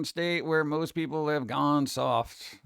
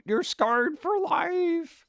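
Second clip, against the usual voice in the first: dramatic mock horror; 13 semitones higher, much slower than usual. Teasing about scarring the children.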